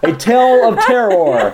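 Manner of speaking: slow, spooky announcer with a laugh; vintage horror narrator. Acted. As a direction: ham up a horror title.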